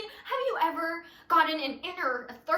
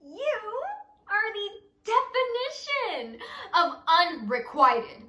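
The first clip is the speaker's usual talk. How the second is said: laughing; 6 semitones higher, 5 dB louder than usual. Acted. Bitter line to Avery.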